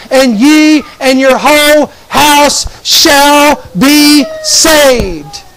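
slow, drawn-out declamation; emphatic scripture quote. Retold or imitated. Preacher quoting Acts to congregation, slow and emphatic. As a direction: stretch each word out like a promise.

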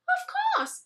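very high squeaky bug voice; tiny high grasshopper. Acted. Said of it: "said Grasshopper".

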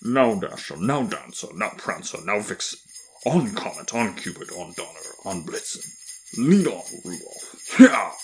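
booming Santa calls, bursting into a loud cheer. A narrator's reading. Santa calling his reindeer to fly, loud and booming. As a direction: call out the team in a big, booming voice. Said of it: "he heard a loud, booming voice".

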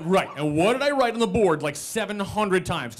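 acted indignant comeback; exasperated retort. Arguing with roommate through door.

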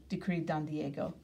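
ordinary delivery

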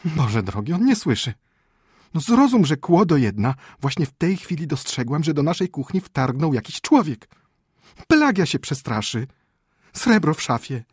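high, loud, agitated; frantic wife at her wits' end; exasperated frightened wife. Acted. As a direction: drive the alarm into a deaf sleeper.

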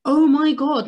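high, loud and very slow Oh my God; real amazement at the discovery; loud drawn-out gasp of disbelief; stunned realization. Real, not acted. Renewed amazement.

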